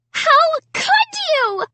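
high, slow scream. A narrator's reading. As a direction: scream the accusation. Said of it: "Sarah screamed".

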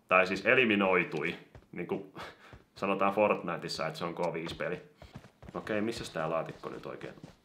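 usual speaking voice

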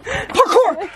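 loud, high triumphant cry; excited shout. Real, not acted. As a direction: yell it as you land the jump. Shouts as she jumps.

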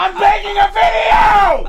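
slow, high, furious scream. Real, not acted. Gamer recording yells at household, screaming. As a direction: drag out every word at full yell. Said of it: "my throat hurts now for screaming".

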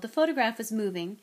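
ordinary delivery

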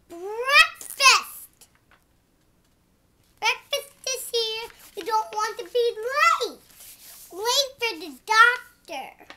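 loud call to breakfast, hurrying; mother calling out. Acted. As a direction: shout from the kitchen, don't be late. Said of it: "And then the mother says".

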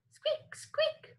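very high, soft squeaks; tiny mouse squeaks. Shown as a demonstration. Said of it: "Oink, oink".

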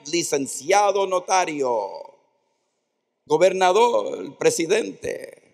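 loud, rising proud roll call of titles; proud rehearsal. Retold or imitated. Teenager practicing his name all night, proud and loud. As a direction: proclaim each title like a grand announcement.

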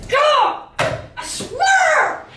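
angry outburst. Acted. Boss venting after an angry parent's call, exasperated outburst. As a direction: explode in frustration, voice jumping up. Furious outburst over losing job.

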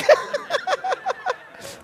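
high bursting laugh at his own joke; breaking up with real laughter. Real, not acted. Preacher laughing at his own long joke, real laughter.

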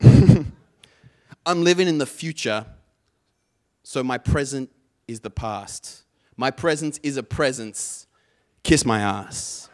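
loud, punchy slam delivery in separated phrases. Acted. Poet finishes practice with [name] lines, slam delivery. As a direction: recite the lyrics like slam poetry, ending on a slow last line. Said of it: "a little bit of [name] to finish us off".

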